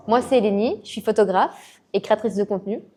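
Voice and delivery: ordinary delivery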